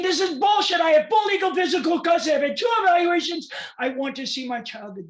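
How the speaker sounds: high, loud indignant shouting; angry outburst. Retold or imitated. Desperate parent protesting to the attorney, angry shouting. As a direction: shout it in outrage, demanding to be heard.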